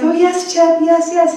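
high, breathless mock fan gushing. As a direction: ham up a starstruck believer. Mocking fans gushing at psychic.

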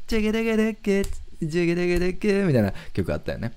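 high mouth-made guitar riff; guitar riff imitation. (高い声でギターリフを口まねする)

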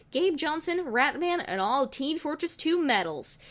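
usual speaking voice

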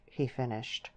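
usual speaking voice

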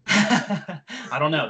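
laughing admission, drawn out. Real, not acted. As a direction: break up laughing as you concede. Laughs at absurd question.

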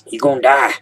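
loud, high put-on doom voice; mock warning. Gamer joking to viewers about drowning, mock-dramatic. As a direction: shout it as a hammed-up threat.

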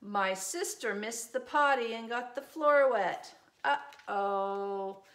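usual speaking voice

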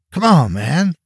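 whiny, protesting appeal; pleading. A narrator's reading. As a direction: plead to be let off. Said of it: "Stephen pleaded".

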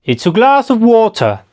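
"It's a glass of water" high and lilting, falling on water; musical English intonation. Shown as a demonstration. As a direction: say "It's a glass of water" in one linked sing-song phrase. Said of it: "cómo va así el idioma".

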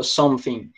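London-style "something", the middle consonant an F; London TH-fronting. Shown as a demonstration. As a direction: say "something" with F instead of TH. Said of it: "ou um F". ("something" com F no lugar do TH, sotaque londrino)

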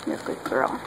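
soft whispered praise to a dog. Real, not acted. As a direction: whisper it gently.

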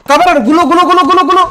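high, loud repeated yells; panicked calling out. Real, not acted. Player under fire calling teammates for cover, frantic shout.

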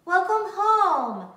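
a bright, loving hello; cheery greeting. Acted. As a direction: greet her like a beaming mom. Mother greeting her daughter.

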